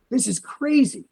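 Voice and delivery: high-pitched imitated amazement; amazed. Lady marvelling at the scan, amazed. Retells medical worker's amazed reaction.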